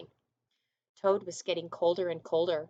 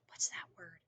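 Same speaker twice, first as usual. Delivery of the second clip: whispered aside to the class; teacher whispers while hunting for a word; 9 dB quieter than usual. Real, not acted. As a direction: hush it as if thinking aloud.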